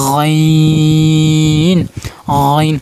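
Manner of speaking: গইন drawn out from the back of the throat, loud and long; like gargling water, a long loud গইন; guttural ghain demo. Shown as a demonstration. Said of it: "যেরকম গলাত পানি রেখে আমরা কুলি করি না".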